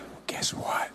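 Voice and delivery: breathy conspiratorial whisper to the crowd; playful whispered aside; stage whisper. Acted. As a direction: lean in and whisper it like a secret.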